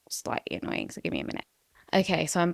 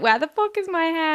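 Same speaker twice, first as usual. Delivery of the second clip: put-on 1 shocked girlfriend; 10 semitones higher, 4 dB louder than usual. Girlfriend's shocked reaction retold.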